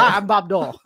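laughing, hammed-up impression; lazy impression through a laugh; bad Bob Dole impression. Friend joins the Bob Dole joke, laughing mock impression. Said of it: "this is a really good impression of Bob Dole".

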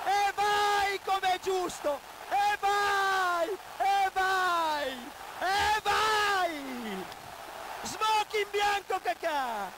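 screaming goal celebration; overjoyed partisan commentator yelling; breathless italian goal commentary. Real, not acted. Kakà equaliser celebration.

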